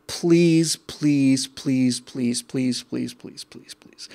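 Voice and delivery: mock plea fading to a whisper; mock pleading, fading. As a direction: plead sarcastically, drifting down to a soft whisper. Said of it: "practically drove me to tears".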